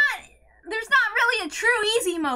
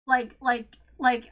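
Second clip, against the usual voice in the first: normal talking voice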